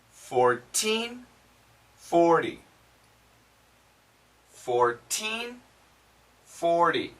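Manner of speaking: Fourteen rising with a clear T, forty falling with a D; teen versus ty contrast. Shown as a demonstration. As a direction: drill Fourteen against forty. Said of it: "it's a D sound".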